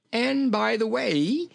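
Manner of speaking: high, slow, stretched-out voice; high-pitched genial king; jolly little king. A narrator's reading. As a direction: draw it out high and merry. Jolly king continues talking.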